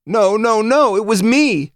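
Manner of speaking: high, loud, slow anguished denial; anguished protest. Acted. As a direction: cry it out, refusing comfort. Psychotech insisting on guilt.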